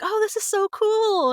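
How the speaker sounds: high, slow, awed mom voice; impressed mom. Retold or imitated. Mom shown the finished show, high and slow. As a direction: gush slowly like a proud mom. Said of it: "she's like".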